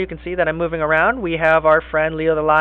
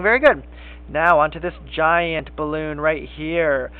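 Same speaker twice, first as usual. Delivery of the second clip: ordinary talk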